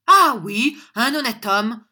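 loud, high-pitched affirmation; emphatic retort. A narrator's reading. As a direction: fling the words back proudly. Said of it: "répliqua John".

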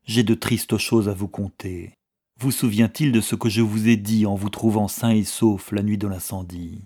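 plain reading voice

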